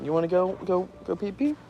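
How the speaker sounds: put-on high, soft baby-talk voice. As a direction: coo it like talking to a toddler. Talking down like to a child.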